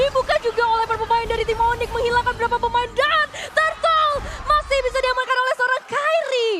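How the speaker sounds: high, loud hype call; excited shout. Real, not acted. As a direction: scream the steal to the crowd. Turtle steal call. (teriakan histeris komentator)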